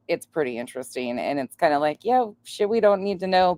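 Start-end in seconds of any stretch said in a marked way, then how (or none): none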